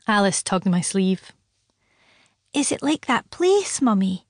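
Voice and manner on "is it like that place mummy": put-on child voice. A narrator's reading.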